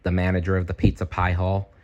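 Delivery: ordinary delivery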